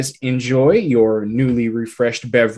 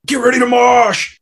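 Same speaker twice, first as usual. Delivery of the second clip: metal shout; 13 semitones higher, 7 dB louder than usual. Acted.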